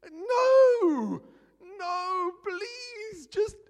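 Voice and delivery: very high, wailing no; mock agonized plea. Pastor hearing a poor prayer, hammed-up agonized wail. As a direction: wail it, begging them to stop.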